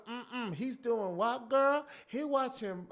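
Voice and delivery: put-on high, loud sassy girlfriend. Girlfriend reacting to the gossip, high and sassy. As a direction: play the outraged best friend. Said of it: "I went to that voice".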